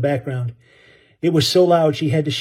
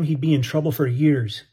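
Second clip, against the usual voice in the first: usual speaking voice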